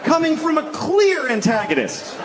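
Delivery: high, strained comic outrage; exasperated rant. Real, not acted. Comic arguing the prank makes no sense, exasperated. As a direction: cry out the objection up high. Comic ranting about the scene.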